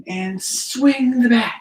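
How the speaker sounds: slow it right down as you swing; slow, drawn out. Shown as a demonstration. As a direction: stretch out swing the bat slowly. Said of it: "Can you do that again?".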